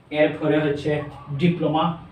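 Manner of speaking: usual speaking voice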